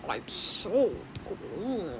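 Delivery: very slow, stretched I'm so green; slow character line. Retold or imitated. Quoting the green one's only line, very slow. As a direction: draw it out like the odd green character. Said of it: "it's Greenity".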